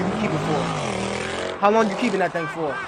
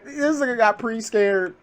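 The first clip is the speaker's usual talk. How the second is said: ordinary talk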